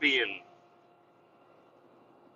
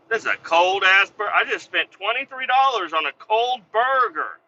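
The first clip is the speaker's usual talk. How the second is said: loud, higher mock outrage; angry complaint; 8 semitones higher, 5 dB louder than usual. Griping at coworkers about a cold burger, loud mock outrage.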